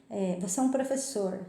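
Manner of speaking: usual speaking voice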